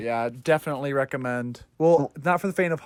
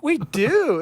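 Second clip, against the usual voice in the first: higher, louder eager reply; mock Harley Quinn; 11 semitones higher, 3 dB louder than usual. Quoting Harley Quinn's eager reply, higher.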